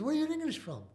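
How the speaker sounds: a hint of a puzzled British corporal; British soldier. Soldier voiced in retold story.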